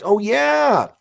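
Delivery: loud, high whooped cheer; excited shout. Real, not acted. Host hyping up the audience to like the show, excited shout. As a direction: burst out with a big high cheer.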